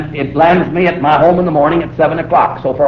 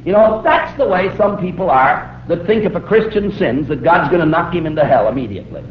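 ordinary delivery

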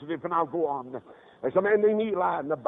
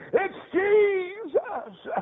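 high, very drawn-out cry; wailing exclamation; 3 dB louder, much slower than usual. Retold or imitated. Mother telling her little fella the name of Jesus, high impassioned cry. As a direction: stretch the name into an imitated motherly wail.

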